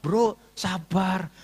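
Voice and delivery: very slow, drawn-out soothing; imitated calming friend. Commissioner telling angry pastor to wait, slowly placating. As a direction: stretch the words to placate.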